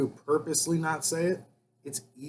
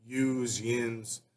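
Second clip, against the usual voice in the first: Philly and Pittsburgh forms; 4 semitones lower, 7 dB quieter than usual. Shown as a demonstration. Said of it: "is like Philly".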